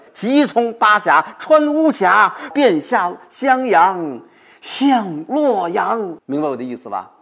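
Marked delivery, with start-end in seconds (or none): 0.1-6.2 higher, louder, slowly stretched final phrase; urgent recitation. Shown as a demonstration. Professor demonstrating the anxious homeward rush, urgent stretched declamation. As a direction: hurry through the gorges then stretch 向洛阳 long. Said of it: "着急了".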